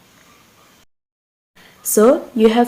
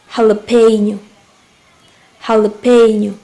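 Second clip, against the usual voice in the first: "Jalapeno" with PE punched louder; stress on PE syllable; 5 dB louder than usual. Shown as a demonstration. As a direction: hit the third syllable of "Jalapeno" hard. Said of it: "also the same as before".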